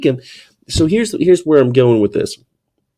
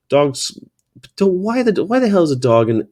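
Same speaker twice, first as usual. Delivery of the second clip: ordinary talk, nothing marked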